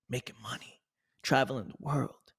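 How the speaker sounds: whispered aside. Real, not acted. Speaker to viewer, listing wins in a whisper. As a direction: drop to a breathy whisper.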